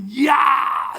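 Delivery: loud war cry; Goliath's yell. Retold or imitated. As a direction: roar it like the giant. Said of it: "이러면서 소리를 지르니까". (골리앗의 고함)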